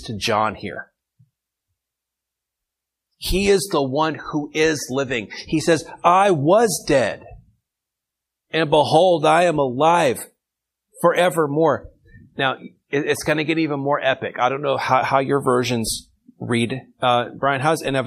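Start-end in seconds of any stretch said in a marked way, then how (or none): 6.0-11.9 proclaiming. Acted. Teacher reading Jesus's proclamation.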